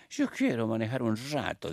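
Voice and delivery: put-on Argentine accent. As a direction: imitate an Argentine pope speaking Spanish. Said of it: "con el acento argentino que tienen los papas".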